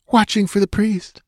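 high, fearful little voice; whimpering. A narrator's reading. Frightened girl telling where Zargeba is, whimpering. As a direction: whimper the answer, high-pitched. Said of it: "she whimpered".

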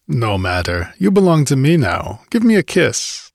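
zestful. Acted. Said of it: "laughed with undiminished zest".

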